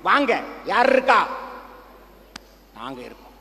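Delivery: normal talking voice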